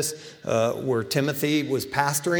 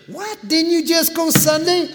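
much higher, incredulous jeering; old friends jeering at his churchgoing, incredulous and much higher; mocking friend; 13 semitones higher than usual. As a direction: sneer in disbelief. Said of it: "what they used to say to me".

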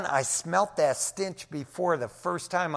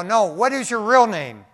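firm demand; 6 semitones higher, 9 dB louder than usual. Retold or imitated. Confronting the possessed man.

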